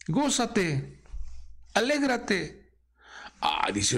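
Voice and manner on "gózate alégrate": high, bright call to rejoice; gózate and alégrate sung out high; joyful exhortation. Acted. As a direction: lift both words up high in joy.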